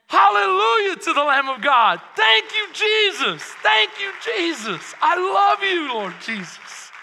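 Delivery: loud, high, ecstatic thanks; fervent praise. Real, not acted. Preacher breaking into worship, loud and ecstatic. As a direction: cry out praise to Jesus.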